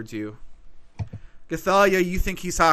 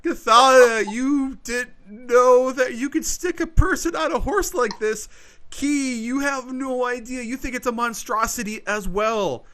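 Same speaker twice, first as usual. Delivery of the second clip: excited; 9 semitones higher, 6 dB louder than usual. Acted. GM hypes the monster reaction.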